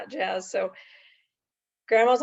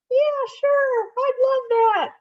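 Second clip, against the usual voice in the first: much higher, louder puppet voice; 8 dB louder, slower than usual. Acted. Puppet greeting the host, much higher puppet voice. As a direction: pop up bright as a little puppet. Puppet accepts story offer.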